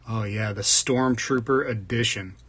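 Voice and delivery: ordinary talk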